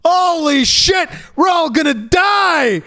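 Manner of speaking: high, slow panicked shrieks; panicked screaming. Retold or imitated. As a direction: scream like everyone's about to die. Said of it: "He's like".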